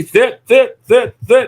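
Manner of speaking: high, loud, insistent sit-sit-sit; frantic repeated commands. Retold or imitated. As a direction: mimic an owner begging the dog to sit over a cookie. Owner nagging dog for cookie.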